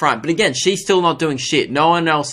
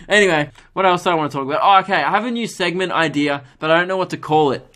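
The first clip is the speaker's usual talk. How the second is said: normal talking voice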